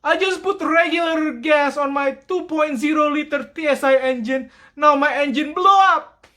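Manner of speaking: high, loud mock whine; mock complaining customer. Customer complaining his engine blew, high mock whine. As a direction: play the customer who wrecked his engine. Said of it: "I'm like".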